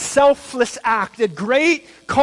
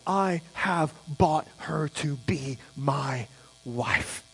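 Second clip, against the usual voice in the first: tender quote; 5 semitones lower, 9 dB quieter, slower than usual. Acted. Tender reread of the vow.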